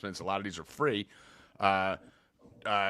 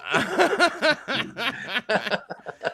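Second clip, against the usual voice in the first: long high laughter; 12 semitones higher, 3 dB louder than usual. Real, not acted. Laughing at bit.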